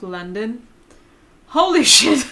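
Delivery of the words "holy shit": laughing exclamation. Real, not acted. Shocked by London outskirts accents.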